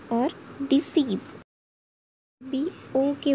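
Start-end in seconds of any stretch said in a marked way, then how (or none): 0.7-1.2 long ई in the end of डिसीव. Shown as a demonstration. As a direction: stretch the ee of डिसीव. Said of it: "ई दीर्घ में होता है". (डिसीव में दीर्घ ई)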